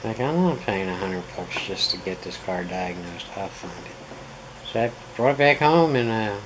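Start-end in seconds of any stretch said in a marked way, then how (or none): 3.3-4.7 determined whisper. Real, not acted. Determined aside.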